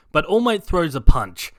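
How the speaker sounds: ordinary delivery, nothing marked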